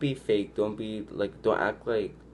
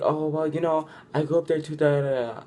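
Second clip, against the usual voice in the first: higher-pitched mock butting in; talking over someone; 7 semitones higher, 4 dB louder than usual. Mimicking a boy talking over a girl about her childhood, mock interrupting. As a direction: imitate someone eagerly cutting in, pitched up. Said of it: "don't talk over her".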